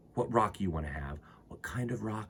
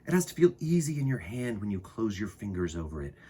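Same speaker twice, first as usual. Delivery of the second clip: put-on unhurried read-aloud for kids; storybook reading; 3 semitones lower than usual. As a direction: read it like storytime to little ones. Reads a children's book aloud.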